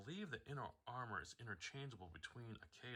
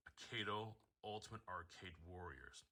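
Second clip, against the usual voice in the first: normal talking voice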